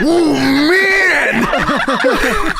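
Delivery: high hammed-up yell, half laughing; mock wrestler meltdown; Macho Man. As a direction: freak out like an outraged wrestler. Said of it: "Macho Man freaks the fuck out".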